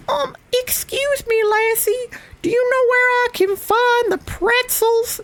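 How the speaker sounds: put-on very high, thin, frail old voice; a gentle old man lost in the shop; sweet little old man. Shown as a demonstration. Asking a shop worker for pretzels, sweet little old man. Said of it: "a little old man kind of a voice".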